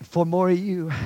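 rising, pleading prayer that builds; preacher praying aloud, building in fervor; fervent prayer. Acted. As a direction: cry out to God with growing urgency.

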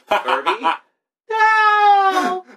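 high shrieking yell, Furby?, then No!; panicked cry. Retold or imitated. Retelling how he cried out at his broken Furby, a high panicked shriek. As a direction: scream at the frozen toy in mock horror.

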